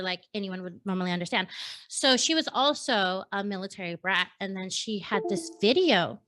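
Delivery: normal talking voice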